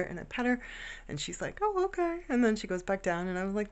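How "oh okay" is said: high squeaky pet voice, lightly put on; mild high-pitched gerbil reply. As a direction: voice the gerbil as if she shrugs it off. Gerbil voiced answering owner.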